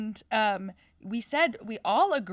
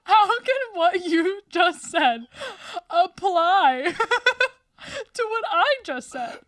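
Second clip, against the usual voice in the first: high, cracking with laughter; can't believe it, laughing; laughing disbelief; 8 semitones higher, 6 dB louder, slower than usual. Real, not acted. Incredulous, laughing at friend.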